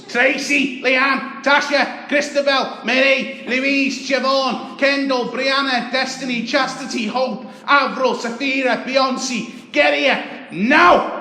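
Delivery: shrieked high and very loud, name after name; screaming mum. Retold or imitated. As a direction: scream the kids' names like a mum at the school gate. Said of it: "she'll be screaming at the top of her lungs".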